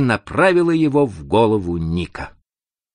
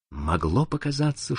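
usual speaking voice

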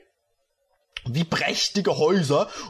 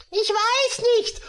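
put-on much higher, slow girlish mumble; high, sad heroine voice. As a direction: mumble like a sulking girl. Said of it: "murmelte sie trau".

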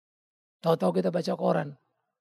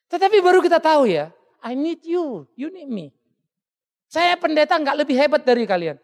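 louder, higher pulpit delivery; fiery preaching; 7 semitones higher, 6 dB louder than usual. Acted. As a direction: drive the point home to the congregation. Preaching with full force.

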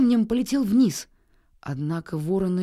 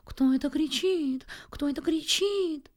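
frightened bird; 7 semitones higher than usual. A narrator's reading. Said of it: "с перепугу".

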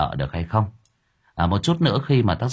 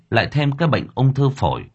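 plain reading voice

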